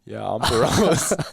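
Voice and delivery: slow, matter-of-fact imitation of the producer saying On Perose; deadpan producer. Producer insisting on the name, a lightly voiced deadpan imitation. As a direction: drawl out Perose like it's obvious. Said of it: "He's like".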